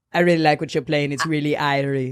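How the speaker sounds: lightly voiced Jamaican uncle; Jamaican accent. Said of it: "another Jamaican accent".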